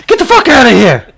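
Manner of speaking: sudden high, very loud shout; explosive yell. Acted. Reacting to talk of brownies, explosive yell. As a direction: bellow it as an outburst. Sudden yelled outburst in play.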